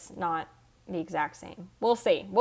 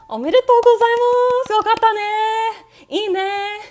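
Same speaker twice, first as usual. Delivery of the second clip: much higher and louder, squealing Japanese cheer; excited anime cheer; 12 semitones higher, 9 dB louder than usual. Acted.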